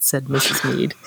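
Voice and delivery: ordinary talk, nothing marked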